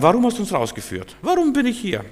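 high, complaining mock whine; hammed-up grumbling of the ungrateful people; whining Israelites. Preacher mimics Israelites complaining in the promised land, high mock whine. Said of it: "die Israeliten im gelobten Land und sagen".

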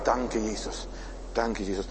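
hushed, intimate gratitude; quiet thankful prayer. Acted. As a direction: pray it gently under your breath.

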